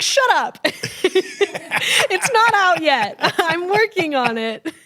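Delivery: high, loud bashful giggle; flustered and laughing, drawn out slowly; giddy shut up. Retold or imitated. Answering the doctor's question, high giggly fluster. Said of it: "And I was like".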